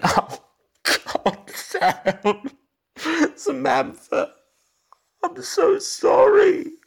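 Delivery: high, loud, slow wailing; breaking down sobbing. Acted. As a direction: break down in sobs. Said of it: "broke apart in front of Bloom".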